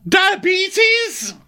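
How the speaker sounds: very high, shouted blurt; mock shriek. A host blurts a wrong name as a joke, mock shriek. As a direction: shriek it out for laughs. Sudden yelled joke answer.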